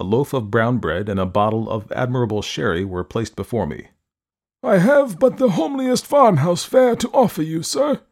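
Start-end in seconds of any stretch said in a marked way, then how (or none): 4.6-8.0 put-on high, formal old man voice; stiffly polite elderly host; courteous old man. A narrator's reading. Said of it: "said my entertainer".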